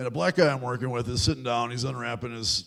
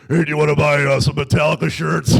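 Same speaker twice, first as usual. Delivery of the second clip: much louder booming sales call; friend's booming telemarketing call, louder; booming phone pitch; 12 dB louder than usual. Retold or imitated. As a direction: boom it down the phone line. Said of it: "going like".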